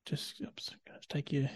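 hushed aside; whisper. Real, not acted. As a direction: drop to a quick whisper. Aside under breath.

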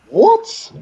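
very high-pitched squeak of disbelief; retold shock. Reacting to sixty thousand plus spectators, high squeaked disbelief. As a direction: let the voice shoot right up, mock-stunned. Retells shock at stadium crowd size.

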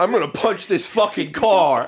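loud, high, slow laughing declaration; mock fighting boast. Mocks Six's absurd fighting boast.

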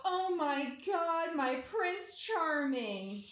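mock swoon. Mocking a smitten girl swooning at her date, slow and dreamy. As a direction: draw it out, starry-eyed.

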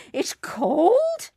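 high, loud yelp; crying out. A narrator's reading. Paddlers feeling the river water, high cry. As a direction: yelp at the chill. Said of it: "they cried".

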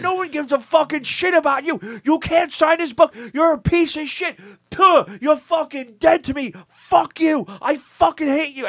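furious fan rant. Retold or imitated. Fan screaming at [name] after waiting three hours, furious high shout. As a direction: scream it at him, spitting every curse. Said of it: "You're done, like".

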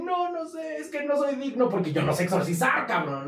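high, pleading cry of unworthiness; protesting saint. Retold or imitated. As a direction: wail it out like a helpless monk. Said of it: "Y él".

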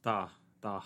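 mock Russian "da"; hammed-up Russian accent. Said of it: "wait, that was Russian".

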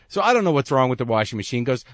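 ordinary delivery

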